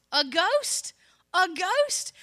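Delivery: high, loud, rising disbelief; incredulous question. Acted. Preacher questioning the disciples' first reaction, loud incredulous question. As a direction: question it twice, loudly, pitch leaping up at the end.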